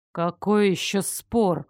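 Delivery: usual speaking voice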